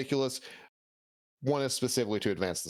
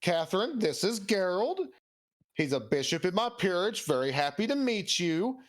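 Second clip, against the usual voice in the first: much higher, cheery go-between voice; Asirio introducing; 8 semitones higher than usual. Acted. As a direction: present them like an eager matchmaker. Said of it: "he says".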